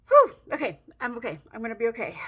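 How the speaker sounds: steady yourself after nearly crying; pulling herself together. Real, not acted. Vlogger calming herself after choking up, shaky reassurance. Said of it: "choked up".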